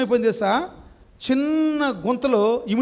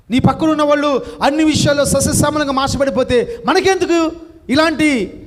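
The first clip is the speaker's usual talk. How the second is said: loud, fervent sermon, climbing higher and louder to the end; preaching at full force; 8 semitones higher, 7 dB louder than usual. Acted. Preacher urging the congregation to change, fervent loud preaching. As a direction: thunder the rebuke, then rise into a prayer.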